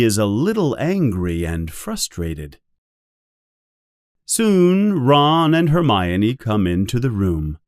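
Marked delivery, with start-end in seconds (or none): none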